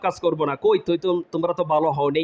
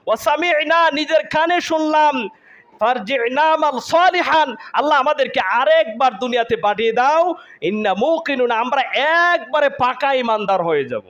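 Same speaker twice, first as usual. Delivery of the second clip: impassioned raised-pitch preaching plea; pleading damned souls; 7 semitones higher than usual. Acted. Hell-dwellers beg Allah to send them back, impassioned pleading. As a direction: beg God urgently, stretching key words.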